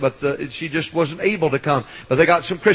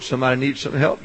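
ordinary talk

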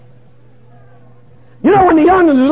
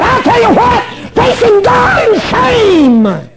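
high-pitched preacher's cry, stretched out at the end; impassioned cry; 8 semitones higher, slower than usual. Real, not acted. Preacher answering what's so bad, high impassioned cry.

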